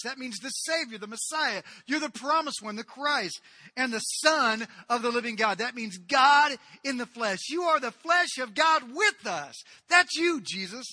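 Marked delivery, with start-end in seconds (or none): none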